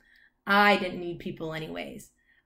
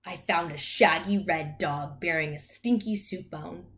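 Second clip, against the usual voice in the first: ordinary delivery, nothing marked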